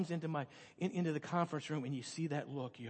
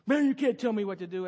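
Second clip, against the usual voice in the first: loud, higher mock kid backtalk; defiant kid; 4 semitones higher, 8 dB louder than usual. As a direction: imitate a kid angrily talking back. Kid talking back, acted.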